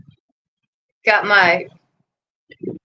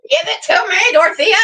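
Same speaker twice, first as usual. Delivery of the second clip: higher, shouted give it to me; shouted command; 9 semitones higher, faster than usual. Real, not acted. Owner repeating the order to her dog, now shouting. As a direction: raise your voice so the dog finally obeys.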